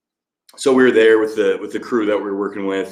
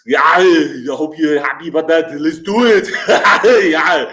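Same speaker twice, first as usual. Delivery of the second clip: loud, high strained Arnold impression; Arnold grunts; 6 semitones higher, 6 dB louder, slower than usual. Livestreamer grunting on a fan's request, hammed-up Arnold shout. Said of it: "one phase of Arnold grunts".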